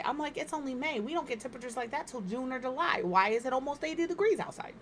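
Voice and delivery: ordinary delivery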